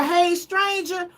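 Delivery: slow, coy hey stranger; sultry text voice; flirty greeting. Pretend. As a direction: drawl it out to tempt him.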